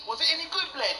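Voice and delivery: put-on rude boy youth. Mate asking about the film, rude boy voice. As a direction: ask it like a bored teenager. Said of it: "It went a little bit like this".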